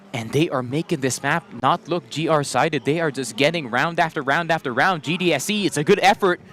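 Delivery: live play-by-play; esports caster. Real, not acted. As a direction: keep calling the action. Play-by-play casting.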